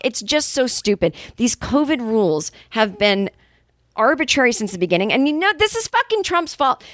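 ordinary talk, nothing marked

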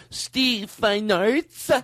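very slow, high, luxuriant name; fancy name-saying. Shown as a demonstration. Host imagining the director hearing it on headphones, fancy. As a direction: stretch the name out high and grand. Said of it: "said his name as fancy as we could".

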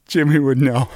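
lightly voiced friend, with a laugh; Jimmy voice.

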